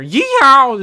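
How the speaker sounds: long, high, loud cowboy whoop; mock yeehaw holler. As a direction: holler it out like a playful Southern yeehaw. Imitating Southern speech kids are punished for.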